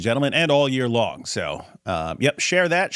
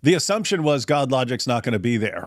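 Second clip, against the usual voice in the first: normal talking voice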